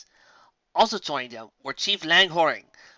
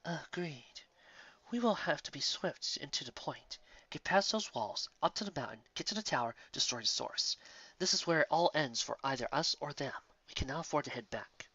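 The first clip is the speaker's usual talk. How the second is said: quiet and final; the stag lord softly sealing the plan; grave hushed stag; 12 dB quieter than usual. A narrator's reading. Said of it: "Whiteheart nodded".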